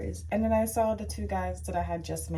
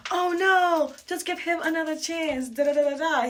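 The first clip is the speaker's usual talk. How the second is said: pleading friend; 9 semitones higher, 6 dB louder than usual. Retold or imitated. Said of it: "His friend goes".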